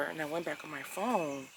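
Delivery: ordinary talk, nothing marked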